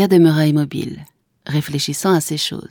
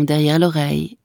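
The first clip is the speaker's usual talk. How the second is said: plain reading voice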